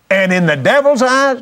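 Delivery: "devil's eyes" pitched high and pressed; pointed emphasis. Real, not acted. Preacher warning listeners to hear him right, pointed emphasis. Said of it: "hear this the way I'm saying it now".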